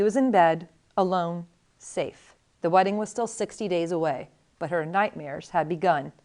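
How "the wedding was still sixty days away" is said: ordinary delivery, nothing marked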